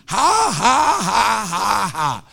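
loud, mock ha-ha in spoken syllables; dry forced laugh. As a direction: push out a fake laugh of defiance. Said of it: "just like that".

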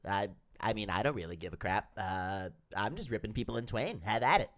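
low, bored demon voice; Terry voice. Acted. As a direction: drone it like an indifferent torturer. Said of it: "Terry, is it cool if".

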